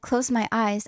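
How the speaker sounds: ordinary talk, nothing marked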